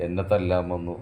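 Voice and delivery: usual speaking voice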